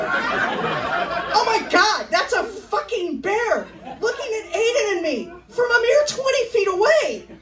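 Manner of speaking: very high, stretched-out panic; panicked. Acted. Hiker facing a bear twenty feet away, shrieking panic.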